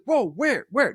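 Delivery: high, startled mock alarm; retold freaking out. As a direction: act out a jumpy search for the spider. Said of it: "I completely stopped freaking out".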